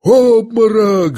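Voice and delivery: high, long-drawn exclamation; bystanders' cry. A narrator's reading. Onlookers see Ganya collapse, crying out. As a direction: cry out, stretching the word. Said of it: "закричали кругом".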